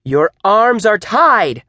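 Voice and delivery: high, loud, slow insistence; exclaiming. A narrator's reading. Teacher improvising an answer to doubting student, loud and insistent. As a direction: fire back an emphatic answer. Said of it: "ended in an exclamation mark".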